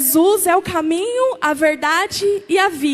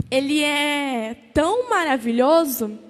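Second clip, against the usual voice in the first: ordinary talk, nothing marked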